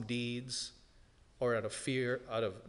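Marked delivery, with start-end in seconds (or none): none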